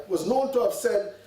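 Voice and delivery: ordinary talk, nothing marked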